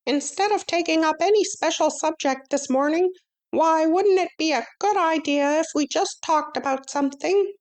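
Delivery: a tiny teacher calling the class to order; high little mouse-teacher voice; small Stuart voice. Acted. Stuart proposes a talk.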